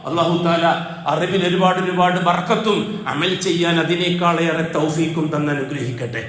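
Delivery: ordinary talk, nothing marked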